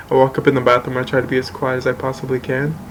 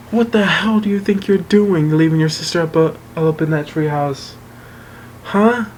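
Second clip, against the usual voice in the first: high-pitched imitation of an outraged parent; mock parental scolding; 4 semitones higher than usual. Parents confront son over abandoned sister, mock outraged scolding. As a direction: scold with a raised pitch, ending on a sharp questioning jump.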